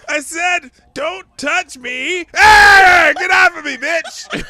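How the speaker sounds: high screaming dog panic; scared and angry. Pretend. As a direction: shriek it as a cornered, furious dog. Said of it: "And the dog's like".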